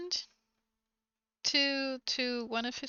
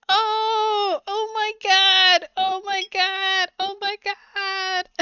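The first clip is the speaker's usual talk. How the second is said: higher, louder, slow repeated gasps; stunned girlfriend; 7 semitones higher, 7 dB louder, slower than usual. Retold or imitated. Girlfriend realizes her boyfriend is her cousin, stunned. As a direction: play her slowly realizing the horror. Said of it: "the girl was like".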